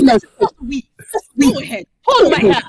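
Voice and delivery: loud, very slow, commanding challenge; daring them in a put-on accent; Storm. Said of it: "I actually do voice her".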